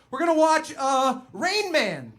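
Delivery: high, loud, halting excuse; high flustered stalling cover story. Retold or imitated. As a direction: stall while inventing a lie. Making up an excuse on the spot.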